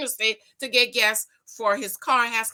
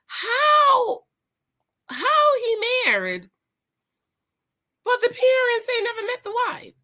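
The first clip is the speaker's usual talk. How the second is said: much higher, louder how, disbelieving; incredulous; 12 semitones higher, 4 dB louder than usual. Real, not acted. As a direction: ask it in shocked disbelief. Incredulous at story.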